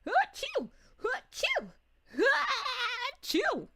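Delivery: high, cartoonish achoo; playful mock sneezing; pretend sneezes. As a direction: act out a fit of sneezing, high-pitched. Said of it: "starts sneezing".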